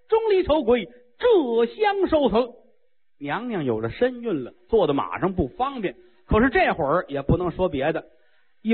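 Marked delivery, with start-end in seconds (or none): 0.1-2.5 battle challenge. Acted. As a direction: shout the challenge across the field.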